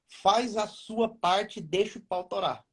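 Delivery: slow and firm, every word weighed; slow emphatic. Shown as a demonstration. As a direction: spell it out slowly for them. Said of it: "Entenderam a expressão ou não?". (devagar e firme, enfatizando cada palavra)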